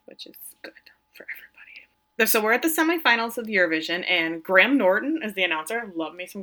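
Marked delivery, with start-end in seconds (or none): 0.1-2.3 voiceless little aside; whispered aside. Real, not acted. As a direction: drop to a whisper as a private joke. Aside after plot point.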